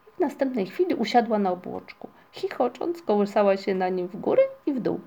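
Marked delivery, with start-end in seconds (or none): none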